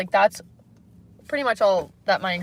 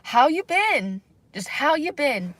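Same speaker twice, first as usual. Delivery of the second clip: a hint of a higher, slow casual greeting; Kevin's greeting; 6 semitones higher, slower than usual. Imitating Kevin's simpler greeting, slightly high and slow. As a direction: ask it easy and drawn out. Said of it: "Let's just do him being like".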